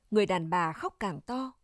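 ordinary delivery

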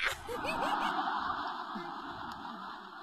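airy rising laugh; whispery laughing fit; breathless laugh. Acted. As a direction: dissolve in laughter. Wife laughs at own joke.

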